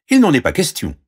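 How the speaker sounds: high, firm refusal; exclaiming princess. Acted. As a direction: indignantly refuse. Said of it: "s'exclama la princesse". (refus indigné de princesse)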